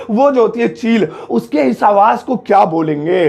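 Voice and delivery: ordinary delivery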